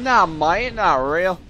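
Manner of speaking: swooping mock Aussie dismissal; hammed-up Australian drawl; awful Australian accent. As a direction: dismiss it in badly faked Australian. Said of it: "the worst accent ever".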